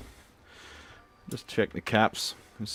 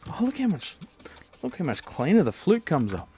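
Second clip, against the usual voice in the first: excited; 8 semitones higher than usual. Acted. Excited over clean sound.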